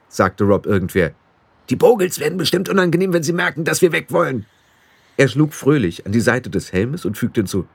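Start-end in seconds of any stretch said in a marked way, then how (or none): 1.7-4.5 high, eager little blue man; squeaky fairy barking an order; high pixie voice. A narrator's reading. Pixie chief urging the boy to run, high eager voice. Rob Anybody's voice.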